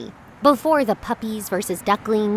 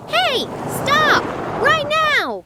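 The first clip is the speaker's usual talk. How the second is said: shouting stop; 13 semitones higher, slower than usual. Acted. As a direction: yell at the car to halt. Shouting at the moving car.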